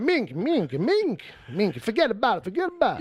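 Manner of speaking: a mock New York tough guy saying forget about it; thick Brooklyn accent. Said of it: "with a real thick Brooklyn accent".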